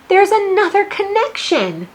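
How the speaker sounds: high, loud announcement; thrilled to find another one; excited. Real, not acted. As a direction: sing it out to the class. Teacher announces another connection.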